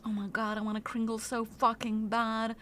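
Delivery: quiet longing. Real, not acted. Streamer craving the pastry, quiet low yearning. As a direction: say it under your breath, yearning.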